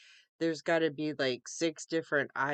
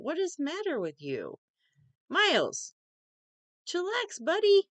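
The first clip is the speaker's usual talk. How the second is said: high sing-song pet voice, much higher; talking to the cat; 12 semitones higher, 4 dB louder than usual. Real, not acted. To her cat Miles who spazzed out, high cooing pet voice. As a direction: coo at a startled cat up high.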